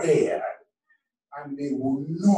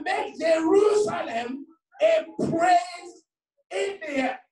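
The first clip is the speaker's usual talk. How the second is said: fervent scripture cry; 13 semitones higher, 4 dB louder than usual. Real, not acted. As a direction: build to a soaring, stretched cry. Declaims scripture, calling for repeats.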